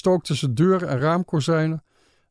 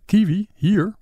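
short, higher-pitched questions; surprised questioning; 4 semitones higher, 3 dB louder, slower than usual. A narrator's reading. Girl surprised by a kiwi growing here, higher and questioning. Girl dares taste fruit, astonished.

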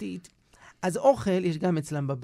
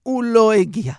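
old man waking from a faint, lightly acted weeping; mock weeping lament; 8 dB louder than usual. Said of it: "הוא פרץ בבכי ואמר".

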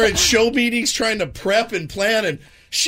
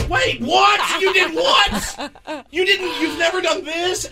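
high-pitched astonished outburst; mock disbelief; 10 semitones higher, slower than usual. Co-hosts hearing an old secret, high incredulous reaction. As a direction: react as if floored by the news. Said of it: "and we'll be like".

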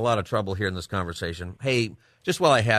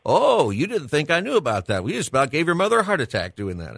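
higher, louder mock scolding from dad; imitating dad's scolding; 7 semitones higher, 4 dB louder than usual. Son retelling dad's surprise reveal on air, mock scolding. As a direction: play a father letting his kid know he knew. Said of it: "You said".

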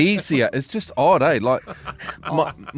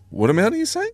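baffled question; 11 semitones higher than usual. Retold or imitated. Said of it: "I'm like".

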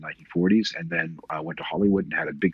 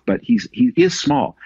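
normal talking voice

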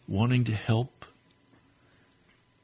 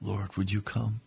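fervent prayer; 2 semitones higher, 3 dB louder than usual. Real, not acted. Preacher praying to the Lord for his listeners, fervent prayer. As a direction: pray earnestly, hushed then rising to a fervent cry.

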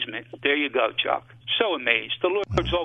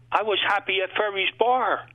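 crying out; 7 semitones higher than usual. Retold or imitated. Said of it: "I cried".

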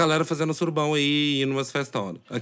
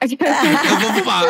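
mock repeat, laughing; 10 semitones higher, 5 dB louder, much slower than usual. Mocking a friend's words.

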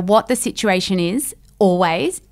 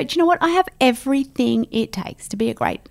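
higher, rallying pep talk to yourself; self pep talk; 6 semitones higher than usual. Retold or imitated. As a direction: psych yourself up, a little higher and bolder. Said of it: "gear yourself up and go".

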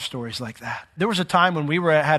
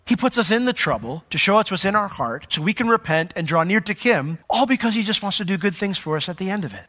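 ordinary talk

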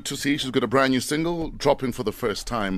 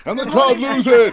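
excited hype shout; 11 semitones higher, 8 dB louder than usual. Acted. Host hyping the guest's entrance.

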